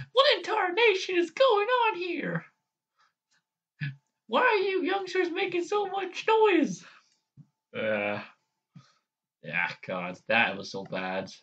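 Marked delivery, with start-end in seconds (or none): 0.1-6.8 put-on very high, creaky old granny voice; Granny Smith. Granny scolding noisy youngsters, very high cranky voice. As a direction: squawk it like a cross old granny. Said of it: "I can probably do Granny Smith".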